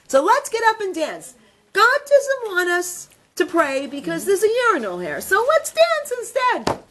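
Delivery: rally your brother to dance, building with delight; the rabbi urges his brother in jail, excitedly; joyful urging. Retold or imitated.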